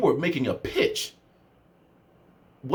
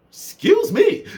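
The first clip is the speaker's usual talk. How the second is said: much higher, louder "Excuse me."; hammed-up offended squeal; outraged mock disbelief; exaggerated disbelief; 6 dB louder, much slower than usual. Mocking the rude message again.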